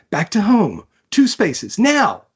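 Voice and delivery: sharp orders rising to a high yell on now; urgent shouted orders. Acted. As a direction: bark the steps at the crew, climbing to a cry. Commanding skiff crew in chase.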